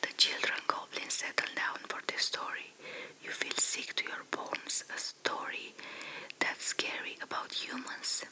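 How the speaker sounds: ordinary delivery, nothing marked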